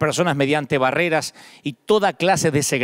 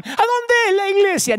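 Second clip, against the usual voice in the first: much higher-pitched put-on questioning voice; imitated eager question; 14 semitones higher than usual. People receiving food ask the preacher, imitated high-pitched question. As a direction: pitch it way up, imitating someone asking.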